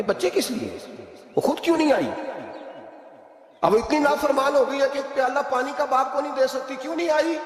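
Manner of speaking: a father demanding why his daughter did not come; angry father. A narrator's reading. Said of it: "حضرت عمر بن عبدالعزیز کو غصہ آیا".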